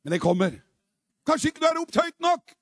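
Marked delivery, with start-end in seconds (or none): 1.3-2.5 high, loud exhortation; raised preaching challenge. Real, not acted. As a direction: challenge them with a lifted voice.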